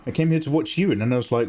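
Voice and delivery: usual speaking voice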